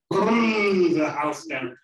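loud, stretched, high BLEW; blowing hard. A narrator's reading. Storyteller showing the wolf blowing, loud and drawn-out. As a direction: act out the gust on blew.

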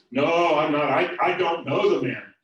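loud, stammering denial pitched high. Acted. Peter to a man at the fire, a loud stammering denial. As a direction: deny it harder, tripping over the words. Peter denies a second time.

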